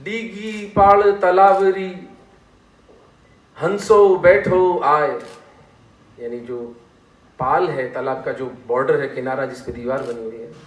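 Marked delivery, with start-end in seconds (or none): 0.0-5.2 very slow, loud, sustained verse; drawn-out poetic recital; couplet recitation. Shown as a demonstration. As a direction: declaim the line, holding the long vowels. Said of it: "तो कवि उस पर लिखता है".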